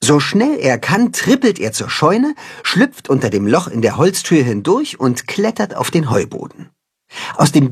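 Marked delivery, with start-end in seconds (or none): none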